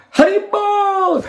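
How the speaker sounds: long drawn-out high call; guru calling out. Retold or imitated. Guru cheering at the newcomer, long high call. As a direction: call it out like the guru greeting him. Guru greets the army man.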